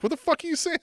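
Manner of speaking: mock baffled squeak. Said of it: "Brock's like".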